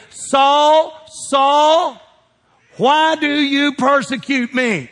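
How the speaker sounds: high, slow calling voice; voice from heaven. Retold or imitated. God calls out to Saul on the road, slow and ringing. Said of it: "he heard a voice say".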